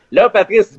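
usual speaking voice

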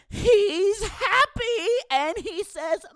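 very high, slow, whiny begging; babyish happy lifter. Acted. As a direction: plead like a pampered baby. Tough lifter turned babyish with girlfriend.

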